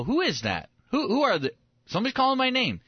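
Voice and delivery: hammed-up bewildered double take; mock puzzlement. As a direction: act confused at hearing someone call. Acting Jesus puzzled, looking around.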